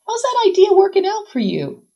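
high, loud, sarcastic question; wry. A narrator's reading. Sarah challenges Nora's faith in science, wry. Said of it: "Sarah asks wryly".

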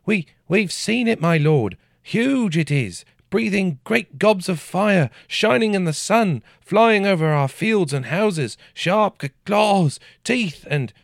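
frightened peasant. A narrator's reading. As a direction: babble it out in terror. Frightened peasant reports dragon.

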